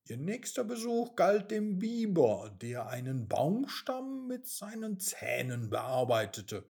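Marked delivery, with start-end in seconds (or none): none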